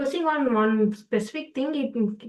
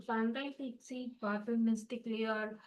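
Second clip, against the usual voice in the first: barely audible; 13 dB quieter than usual. Real, not acted. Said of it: "Can you speak loudly?".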